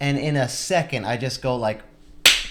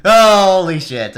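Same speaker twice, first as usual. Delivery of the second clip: slow, much louder alarmed exclamation; alarmed dad; 5 semitones higher, 12 dB louder, slower than usual. Retold or imitated. Dad realizing his sons are hurt, a slow loud outburst. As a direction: let it burst out slowly. Dad hearing about two boys.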